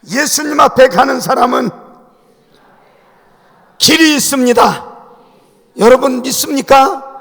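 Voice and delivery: loud, rising preacher's call to the congregation; full-force preaching. Acted. Preacher to his congregation, loud rousing sermon call. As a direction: proclaim it and demand an amen.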